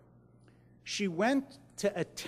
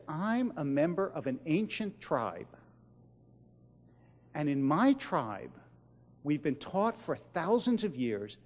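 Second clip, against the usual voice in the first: quiet, sincere quote; 4 semitones lower, 4 dB quieter than usual. Retold or imitated. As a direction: say it softly, as a solemn confession. Visitor quotes her reply to woman.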